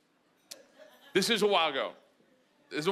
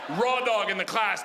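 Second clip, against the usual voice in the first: usual speaking voice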